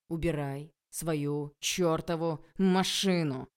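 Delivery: very slow, loud, hateful bark; furious bark. A narrator's reading. Enraged lecturer barking at the girl. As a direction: bark it with all the hate in the world. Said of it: "рявкнул владыка".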